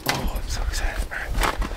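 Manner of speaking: hushed excited whisper. Real, not acted. Owner before the first start, nervous whisper. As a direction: breathe it out under your breath.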